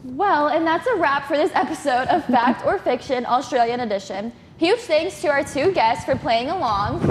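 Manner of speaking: upbeat, loud host sign-off; show host outro. Acted. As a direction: wrap up the episode like a host.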